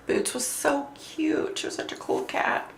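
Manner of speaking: high, soft fond murmur; tender hush. Real, not acted. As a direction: speak of the lost cat softly. Fond memory of dead cat.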